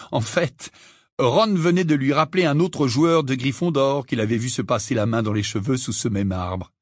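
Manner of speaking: usual speaking voice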